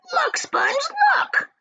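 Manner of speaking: high, amazed cry; astonished aunt. Acted. Aunt Spiker seeing the peach grow, high and amazed. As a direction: gape and point it out, high.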